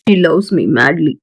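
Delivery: very slow, low, savouring; dreamy. Acted. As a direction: stretch it out like a man lost in love. Arjun savours her love.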